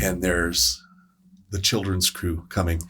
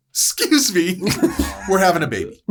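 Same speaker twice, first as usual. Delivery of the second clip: high, louder urgent call with a laugh; urgent announcement; 11 semitones higher, 5 dB louder than usual. Retold or imitated. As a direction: call forward high and alarmed. Sammy calls from back urgently.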